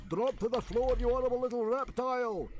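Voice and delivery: high, strained shouting; yelled command. A narrator's reading. Stoic showing how to handle Toothless, furious yelled order. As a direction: roar it in one furious high stream. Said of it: "yelled Stoic".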